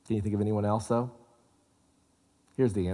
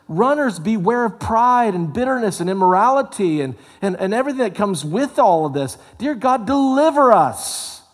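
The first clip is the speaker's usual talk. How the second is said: full-force preaching; 11 semitones higher, 7 dB louder than usual. Acted. Preacher urging runners to pray for deliverance, in a high, loud pleading preaching voice. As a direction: cry out like a preacher at the climax.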